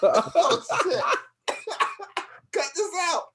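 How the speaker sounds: high, loud laughing outburst; loud high laughing panic. Real, not acted. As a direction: crack up at the blooper. Flubbed intro, laughing.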